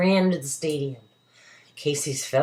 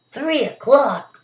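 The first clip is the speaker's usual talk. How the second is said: surprised; 10 semitones higher, 6 dB louder, slower than usual. Acted. Character startled about the time.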